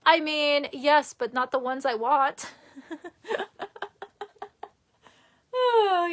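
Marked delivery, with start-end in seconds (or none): 2.8-4.6 light high-pitched giggle, soft. Real, not acted. Laughing at a chat joke, soft high giggle. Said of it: "you're so funny".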